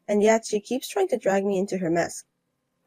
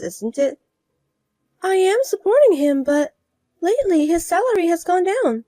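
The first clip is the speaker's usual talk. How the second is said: higher, coaxing request; higher voiced woman asking a raise for her husband; wheedling rival wife; 8 semitones higher than usual. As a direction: coax her for a favor, higher. Asking a favor.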